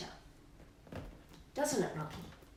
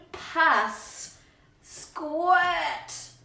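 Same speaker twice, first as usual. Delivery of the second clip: bouncy rhyming read-aloud, louder on the gross bits; reading to children; 4 semitones higher, 6 dB louder, slower than usual. Acted. Reading the birthday verse to Rocky, bouncy and relishing. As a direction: savour every disgusting detail for the child.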